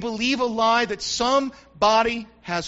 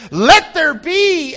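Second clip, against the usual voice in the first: loud, slow, drawn-out proclamation; a big, slow announcement from heaven; booming voice of God; 6 semitones higher, 11 dB louder, much slower than usual. Acted. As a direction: boom it out like the creator speaking. Voicing God creating the world.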